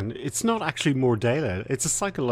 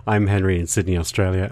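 usual speaking voice

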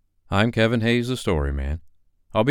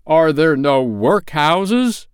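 slow, loud, scornful throwback; mocking echo; 9 semitones higher, 7 dB louder, much slower than usual. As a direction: throw his words back at him. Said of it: "with his own words".

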